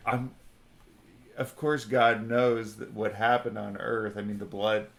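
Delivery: normal talking voice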